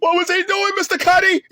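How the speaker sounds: high, giggling question; can barely get it out for laughing; laughing while asking. Real, not acted. Asking [name] about [name]'s villain pose, laughing. Laughing at his own joke.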